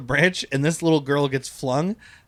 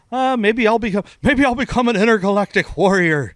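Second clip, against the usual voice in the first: put-on a higher, wondering old-scientist voice; Christopher Lloyd impression; 6 semitones higher, 4 dB louder than usual. Quoting Christopher Lloyd in the movie, as a higher, wondering old-scientist voice. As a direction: muse it like a dreamy Doc Brown. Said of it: "Christopher Lloyd's like".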